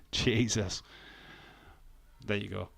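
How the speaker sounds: ordinary talk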